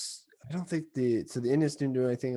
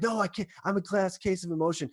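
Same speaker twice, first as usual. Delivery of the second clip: much higher, hammed-up overwhelmed voice; panicky Rizzo; 8 semitones higher than usual. Rizzo refusing to calm down, much higher mock panic. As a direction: squeal it like a player about to burst. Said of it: "Rizzo's like".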